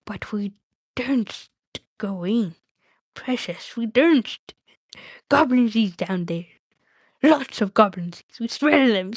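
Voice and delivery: hesitant, hissy creature voice; halting creature muttering with emphatic bursts; Gollum, afraid. Acted. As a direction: shrink from danger as Gollum.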